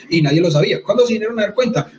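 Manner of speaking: ordinary talk, nothing marked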